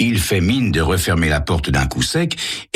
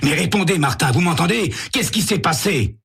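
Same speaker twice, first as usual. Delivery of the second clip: urgent; 11 semitones higher than usual. A narrator's reading. Sam urging dazed man.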